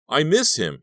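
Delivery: blurted out. A narrator's reading. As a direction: break down, words bursting out. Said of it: "he blurted out".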